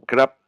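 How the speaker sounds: krap punched high and loud; polite particle krap. Shown as a demonstration. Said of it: "dans les particules de politesse". (krap lancé haut et fort)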